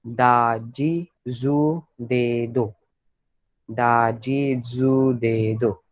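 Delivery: very slow, each syllable दा जी जू दे दो spaced out; slow syllable drill. Shown as a demonstration. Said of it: "teacher models the row slowly".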